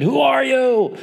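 high, frightened cry into the fog; scared yell. Retold or imitated. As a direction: cry out in fear at an unseen presence. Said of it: "he gets really scared and he yells out to it".

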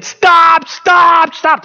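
high, loud pleas to halt; dramatic wife. Retold or imitated. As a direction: yell it like an alarmed wife. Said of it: "Talking about drama".

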